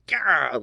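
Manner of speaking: frustrated outburst. Retold or imitated. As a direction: blurt a short, loud cry of exasperation. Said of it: "I was just like".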